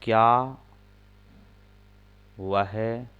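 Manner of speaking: slow, word by word with long pauses; slow dictation pace; dictating word by word. Shown as a demonstration. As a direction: dictate each word so students can write. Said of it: "एक sentence बनाना है".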